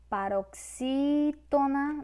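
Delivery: "Paroxítona" slowed, with a long SÍ; stretched stressed syllable. Shown as a demonstration. Teacher spelling out a term for students, stretched stressed syllable. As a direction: draw out the SÍ in "Paroxítona".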